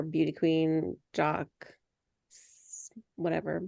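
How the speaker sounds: normal talking voice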